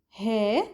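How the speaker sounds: "he" as a bare "heh"; dropped syllable. Shown as a demonstration. Teacher showing a regional short form, dropped syllable. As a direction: cut the word down to "he", one syllable. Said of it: "drop the last part".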